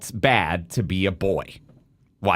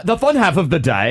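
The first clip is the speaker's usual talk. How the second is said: playful, higher mock-British accent; put-on British vowels, amused; 6 semitones higher, 4 dB louder than usual. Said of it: "singing in British accents off the air".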